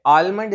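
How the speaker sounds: आलमंड with a spoken L; mispronounced almond. Shown as a demonstration. Teacher warning learners about a common error, L mispronounced. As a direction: pronounce the L in आलमंड. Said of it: "इसकी pronunciation नहीं होती".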